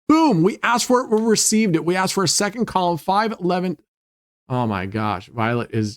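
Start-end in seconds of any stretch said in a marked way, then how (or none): 0.1-3.8 excited. Real, not acted. Requested column hits on wheel.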